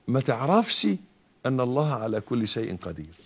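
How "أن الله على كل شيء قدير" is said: normal talking voice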